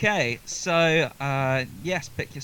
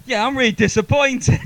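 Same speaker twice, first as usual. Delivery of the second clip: joking through big laughter; high laughing mock disappointment; 13 semitones higher, 10 dB louder than usual. Real, not acted. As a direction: giggle out a fake complaint. Host jokes after the song.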